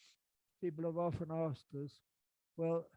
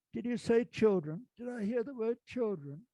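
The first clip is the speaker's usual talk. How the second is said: loud, higher mock-incredulous repeat; incredulous outburst; 5 semitones higher, 7 dB louder than usual. Retelling how he challenged the director, loud and incredulous. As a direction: throw the word back at him, loud and high.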